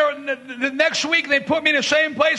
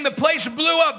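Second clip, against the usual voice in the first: ordinary talk, nothing marked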